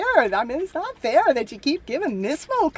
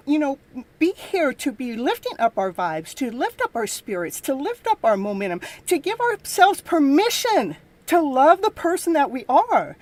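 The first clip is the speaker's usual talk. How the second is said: ordinary talk, nothing marked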